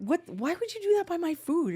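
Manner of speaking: high, indignant mock protest; playing up the outrage over her noodles; mock outraged. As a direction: squeal it out, hammed-up offended. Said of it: "and I was mad".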